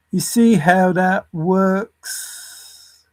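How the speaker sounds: very slow, every word stretched; slow, emphatic question with a long final word; drawn-out repeat. Real, not acted. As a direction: drag it out to make the point.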